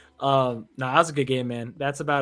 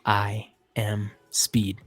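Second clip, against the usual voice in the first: slow, low, hushed movie-hero line; solemn pre-race self-psych, very slow; Lightning McQueen; 5 semitones lower, much slower than usual. Acted. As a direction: drop low and space out each word. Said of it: "He went full on Lightning McQueen".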